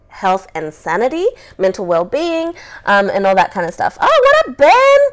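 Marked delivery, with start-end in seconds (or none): 4.0-5.0 high, loud, bubbly greeting to the listeners; excited shout-out. Real, not acted. Host greeting live viewers by name, excited and loud.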